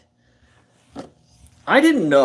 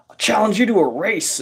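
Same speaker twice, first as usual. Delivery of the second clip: higher, taunting athlete voice; cocky challenge; 10 semitones higher than usual. Retold or imitated. Athlete friend daring the narrator to a race, cocky and higher. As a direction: toss out the dare with a smirk.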